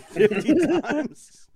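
laughing. Real, not acted. Laughing at the backwards post. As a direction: break into laughter on the punchline.

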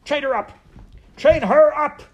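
high, very loud order building; shouting. A narrator's reading. Rat ordering maid to chain princess, escalating shout. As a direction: yell the command in a fury. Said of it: "shouted Rescue".

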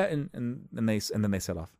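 usual speaking voice

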